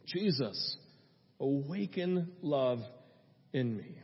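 low, soft, slow prayer; hushed, unhurried petition; simple prayer. Shown as a demonstration. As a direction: pray it quietly, phrase by phrase. Models a short prayer.

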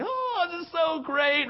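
high, drawn-out delighted cry; fullest joy. Retold or imitated. Preacher reliving his dream joy, elated and drawn out. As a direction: stretch it out slowly, pitch soaring with delight. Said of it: "the fullest expression you could ever imagine".

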